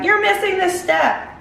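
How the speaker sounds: high-pitched mock complaint; imagined complaining viewer. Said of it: "So if you're like".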